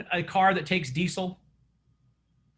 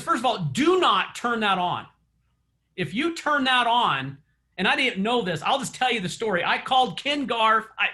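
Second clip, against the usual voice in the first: ordinary delivery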